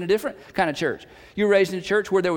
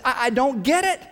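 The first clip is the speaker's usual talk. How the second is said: ordinary delivery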